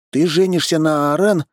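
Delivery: high-pitched surprised question; astonished exclamation. A narrator's reading. Friend learning who the groom will marry, high exclamation. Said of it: "воскликнул Дима".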